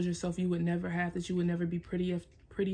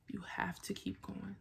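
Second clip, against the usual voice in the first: whispered to herself, much softer; 10 dB quieter than usual. Real, not acted. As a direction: breathe it as a soft whisper of encouragement. Whispered repeat of the same line.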